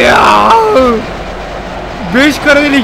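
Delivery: loud, high triumphant yell; battle roar. Real, not acted. (savaş narası)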